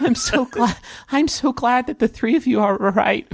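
put-on high, weepy child voice; tearful little boy. Boy is glad the three are all right, high tearful voice. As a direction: squeeze out relief like a crying kid.

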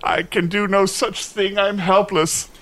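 high, plaintive knight; hammed-up helplessness; despairing refusal. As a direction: moan that the task is beyond you.

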